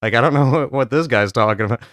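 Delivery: ordinary talk, nothing marked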